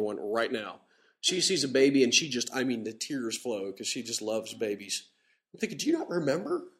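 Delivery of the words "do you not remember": high-pitched imagined question to his wife; incredulous. Retold or imitated. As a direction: ask it in mock disbelief.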